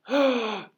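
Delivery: loud, high crowd gasp; shocked gasp. Acted.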